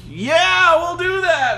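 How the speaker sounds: high, loud crowd cheer; mock eager cheer. Villagers answering the hero, mock eager cheer. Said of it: "And they're like".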